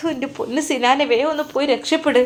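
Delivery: urgent pleading. A narrator's reading. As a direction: beg him to get away fast.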